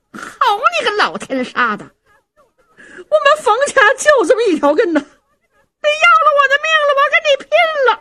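very high, wailing wife's tirade; hysterical fury. Acted. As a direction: scream that you'll fight him to the death. Wife attacks husband, wailing threat. (尖声哭闹撒泼)

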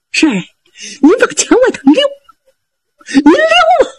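put-on high, laughing urge from a sly young fighter; high cheeky hero urging his lord on; Zhao Bi's high voice.

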